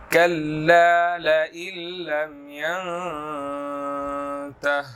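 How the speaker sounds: slow, drawn-out melodic tajweed recitation; Quran recitation. Shown as a demonstration. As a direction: recite the verse slowly with long held vowels. Teacher recites verse as model.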